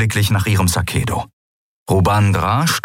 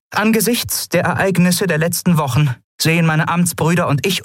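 higher, official declaration building to a plea; formal demand; 10 semitones higher than usual. Acted. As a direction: read the vote of no confidence with steely resolve. (förmlich, entschlossen, am Ende flehend)

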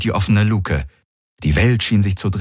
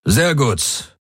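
commander's voice, pitched up from narration; raised warlord character voice; 6 semitones higher than usual. A narrator's reading. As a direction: acknowledge it as a commander in the field. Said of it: "sagte der Feldherr".

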